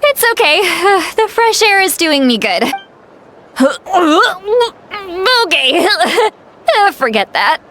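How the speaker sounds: tipsy, giggly and high-pitched. Acted. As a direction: slur it out a bit giddy after too many drinks. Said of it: "I hit it a little too hard".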